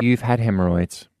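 normal talking voice

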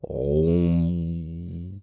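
long, droning "om" held on one note; chanted oracle "om"; oracle's trance hum. Acted. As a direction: intone a drawn-out meditative hum. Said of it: "Og Orakel siger".

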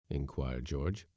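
ordinary delivery, nothing marked

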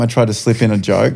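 ordinary talk, nothing marked

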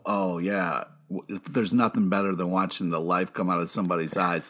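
low, soft villain's voice; creepy killer trucker. Retold or imitated. Host quoting the murderous trucker to co-hosts, low and creepy.

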